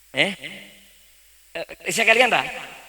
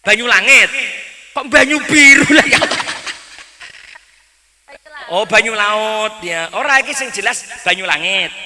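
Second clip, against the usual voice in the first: excited argument; 7 semitones higher, 8 dB louder than usual. Real, not acted. Arguing about song title.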